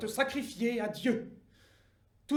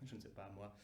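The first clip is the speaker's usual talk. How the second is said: ordinary delivery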